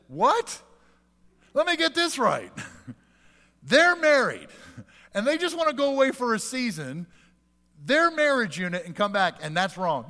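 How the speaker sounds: hammed-up "you can't be serious"; mock incredulity. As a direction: play up the outrage of disbelief. Pastor's incredulous retort again.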